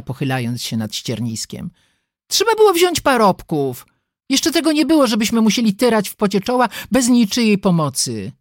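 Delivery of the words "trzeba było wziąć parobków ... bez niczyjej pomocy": put-on high, indignant girlish grumbling; whining complaint. A narrator's reading. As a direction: complain loudly in a high whine. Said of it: "jęknęła dziewczyna". (wysokie, jękliwe narzekanie)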